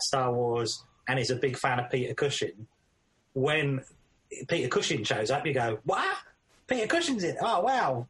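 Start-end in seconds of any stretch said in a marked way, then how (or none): 5.8-8.1 high, astonished film-fan reaction; high-pitched delighted disbelief; surprised fan. Acted. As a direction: squeal in mock amazement at a surprise cameo. Acting out surprise at a cameo.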